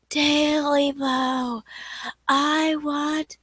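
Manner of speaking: slow, high, drawn-out moaning call; slow ghostly wail; eerie ghost voice. Acted. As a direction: moan it like a hungry spirit in the dark. Ghostly voice heard again.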